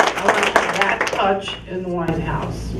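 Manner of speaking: ordinary talk, nothing marked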